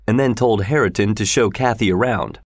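usual speaking voice

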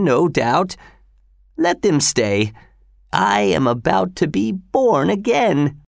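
put-on old father voice. A narrator's reading. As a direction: voice the frail father, rising. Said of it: "Bartolomeo just smiled and said".

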